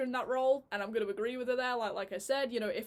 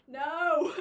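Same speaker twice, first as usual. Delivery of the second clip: raised, loud mock-protest; exclaimed refusal; 5 semitones higher, 6 dB louder than usual. Reviewer reacting to out-of-place choreography, loud mock protest. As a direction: burst out in mock dismay, about to laugh.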